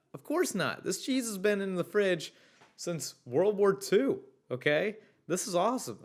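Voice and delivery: can't contain the glee at the story; excited. Real, not acted. Delighted reaction to story.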